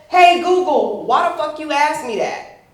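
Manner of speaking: loud, high indignant demand; acted scolding. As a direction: tell off the smart speaker.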